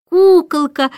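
put-on little John admires the baby, delighted. A narrator's reading. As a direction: gush over the baby. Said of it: "восхищался Джон".

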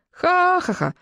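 high, spelled-out mock laugh; boys' guffaw. A narrator's reading. Boys laughing at the howling bully, guffawing. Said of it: "захохотали мальчишки".

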